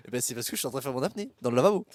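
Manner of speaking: laughing. Real, not acted. Laughing confession about sink apnea.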